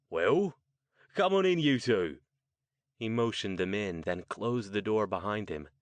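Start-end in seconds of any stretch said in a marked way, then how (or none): 0.1-2.2 loud, high Cockney welcome; thick Cockney. A narrator's reading. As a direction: usher them in with a broad Cockney voice. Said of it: "in a thick Cockney accent".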